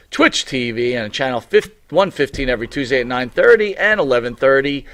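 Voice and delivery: loud, upbeat broadcast plug; announcer voice. Acted. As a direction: pitch the show to viewers.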